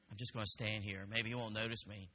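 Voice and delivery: low, soft, to oneself; hushed inner thought. Retold or imitated. Nervous recruit hopes instructor won't notice him, quietly. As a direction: keep small and quiet to escape notice. Frozen recruit hoping to go unseen.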